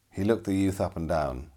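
plain reading voice

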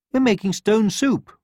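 high bright hen; proud of the soup; cheerful hen. A narrator's reading. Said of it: "said the hen".

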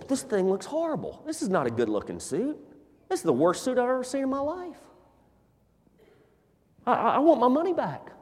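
rising, fast, indignant protest over the suit; upset complaint. Acted. Customer protests to the tailor about a horrible suit, indignant.

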